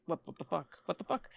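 very soft, stunned muttering; hushed disbelief. Retold or imitated. Retold disbelief after three wasted hours.

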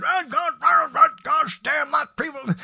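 imitating dad getting worked up over the news; father's outburst. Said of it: "Doing my father now".

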